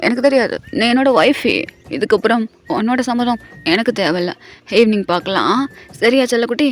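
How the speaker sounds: low teasing male voice; playful husband. Retold or imitated. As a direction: drop low and tease her. Husband claims wife, says bye. (தாழ்ந்த குரலில் கிண்டல் கணவன்)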